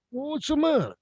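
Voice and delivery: put-on squeaky high-pitched old man asking; high old friend. Old friend asks Tony what's wrong, in a squeaky high voice.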